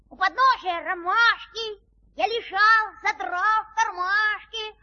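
reciting a poem. Acted. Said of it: "Вот слушайте".

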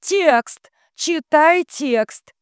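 high, loud, stretched command; almost cry out the order; urgent high-pitched call; near shout. A narrator's reading. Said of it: "почти выкрикивает".

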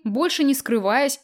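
usual speaking voice

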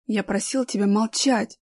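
usual speaking voice

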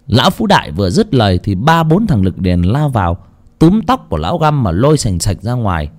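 usual speaking voice

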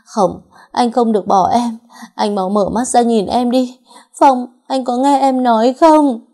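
high, loud, slow anguished wail; wailing in grief. A narrator's reading. Wife over her dead husband's body, wailing loudly. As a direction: cry out to him through tears. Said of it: "mà gào khóc lên".

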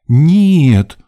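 high, loud outraged cry; theatrical indignation; indignant. A narrator's reading. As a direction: protest like an affronted diva. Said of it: "возмутилась дама".

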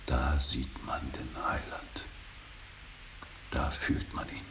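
hushed, almost breathless awe; reverent whisper. Acted. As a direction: whisper it like a secret prayer. Reverent hushed speech about the Saviour. (ehrfürchtig geflüstert)

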